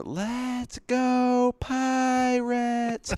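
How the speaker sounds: very high, drawn-out fan chant; high-pitched kid's ballpark cry, stretched out; imitated little girl yelling. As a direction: mimic a little girl cheering from the outfield. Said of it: "she kept yelling".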